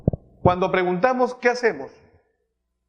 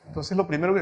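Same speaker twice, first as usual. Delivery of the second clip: ordinary talk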